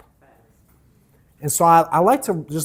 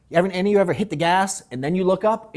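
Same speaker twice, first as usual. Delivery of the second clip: ordinary talk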